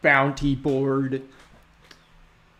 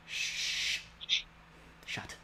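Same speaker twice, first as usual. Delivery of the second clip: shushing; 19 dB quieter than usual. Real, not acted. DM silencing players.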